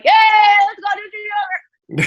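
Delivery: oversell it like a party host; hyped party host. Retold or imitated. Imitates a hyped party yoga teacher.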